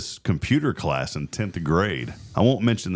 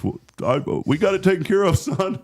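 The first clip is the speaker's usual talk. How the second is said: high, raised call to his son; imitated dad; 13 semitones higher than usual. Dad leaving the principal's office, imitated high call to son. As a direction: toss it out like a dad in passing.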